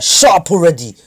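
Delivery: raging shout at the rival who pities him; angry shout. Retold or imitated. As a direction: yell him down in rage. Sasuke furious at Naruto.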